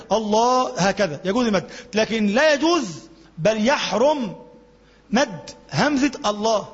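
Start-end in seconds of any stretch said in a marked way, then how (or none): none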